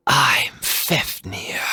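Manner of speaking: high breathy whisper, slow; whispered menace. Acted. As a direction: hiss it like a threat. Fafnir introduces himself whispering.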